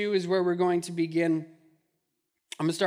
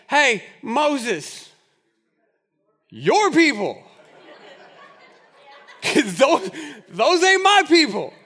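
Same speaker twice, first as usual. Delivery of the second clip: higher, louder, laughing disowning voice; exasperated God; 5 semitones higher, 6 dB louder, slower than usual. Retold or imitated. God handing the blame to Moses, fed-up and laughing. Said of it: "and God's like".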